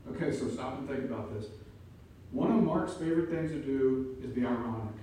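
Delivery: usual speaking voice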